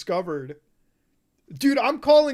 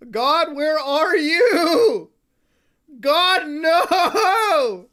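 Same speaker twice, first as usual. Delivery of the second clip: loud, slow mock crying to God, half laughing; theatrical laugh-cry lament; hammed-up wailing; 5 semitones higher, 7 dB louder, much slower than usual. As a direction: wail it like a fake mourner, laughing through it. Mock wail at the video.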